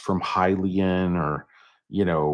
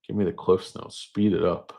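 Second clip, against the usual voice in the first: ordinary talk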